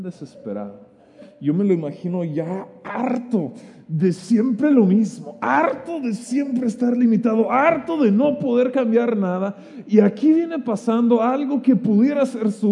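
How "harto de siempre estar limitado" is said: ordinary talk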